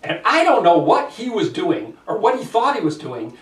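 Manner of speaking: ordinary talk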